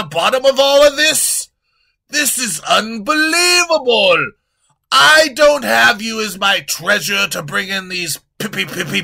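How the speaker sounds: loud, high shouting king; loud, high king shouting at his treasurer; furious king. Acted. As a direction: blow up and order the kill. King shouts, orders kill.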